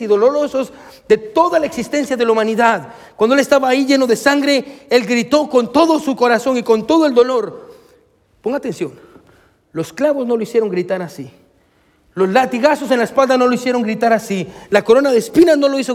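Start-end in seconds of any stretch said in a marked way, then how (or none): none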